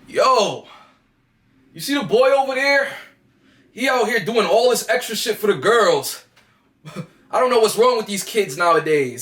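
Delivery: put-on a relaxed older guy scoffing, with a laugh at the end; cool, laughing mockery. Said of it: "on some cool shit".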